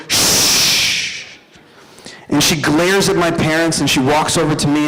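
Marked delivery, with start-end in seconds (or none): 0.1-1.4 long, voiceless shushing; imitated shush. Six-year-old sister silences screaming parents, imitated long shush. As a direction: hush them with one drawn-out hiss. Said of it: "and she just goes".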